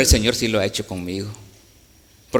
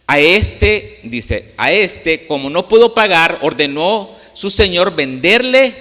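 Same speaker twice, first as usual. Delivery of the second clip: louder, higher pulpit reading; scripture reading; 4 semitones higher, 7 dB louder than usual. Acted. Preacher reading the parable aloud, louder and proclaiming. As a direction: proclaim the verse to the congregation.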